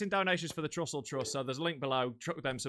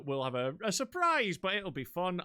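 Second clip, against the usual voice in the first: usual speaking voice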